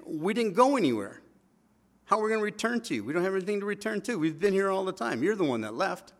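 acted aggrieved retort, pitched well up; indignant protest. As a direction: argue back as if wrongly blamed.

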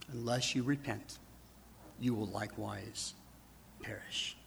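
soft whispered warning fading out; hushed warning. Retold or imitated. Jesus warning the congregation to repent, hushed whisper. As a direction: let it fade softly to a whisper on perish. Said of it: "say the same thing".

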